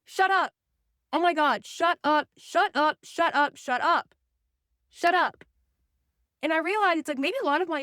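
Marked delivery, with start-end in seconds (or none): 0.1-0.5 higher, louder snap of shut up; sharp exasperated shut up. Real, not acted. Snapping at her own inner critic. 1.1-5.4 louder, insistent shut up over and over; fed-up looping shut up; exasperated repeated shut up. Acted.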